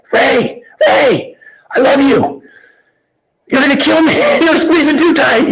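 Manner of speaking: to his friend mid bear hug, high strained laughing plea; squeezed-out plea. Retold or imitated. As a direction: squeak it out as he crushes you in a hug. Being crushed in a hug.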